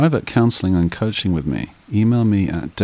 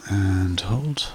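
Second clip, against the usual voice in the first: slow, calm meditation guide voice; guided meditation; 6 dB quieter, slower than usual. Acted. Meditation host guiding listeners to relax, calm and unhurried. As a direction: ease the listener down into stillness.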